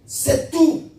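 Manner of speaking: high, punched exhortation; exhortación de predicador. Real, not acted. Preacher exhorts congregation. (exhortación enérgica en voz alta)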